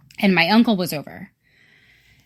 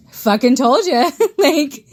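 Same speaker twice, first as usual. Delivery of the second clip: laughing; 6 semitones higher than usual. Real, not acted. Telling friends the ghost gave the clothes back, laughing. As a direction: crack up while gloating that you were right.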